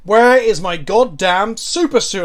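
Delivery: slow, loud demanding shout; Frozone quote. Retold or imitated. Streamer quotes a movie hero hunting a card, slow loud shout. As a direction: yell for the suit like the cartoon hero. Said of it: "And by Super Suit".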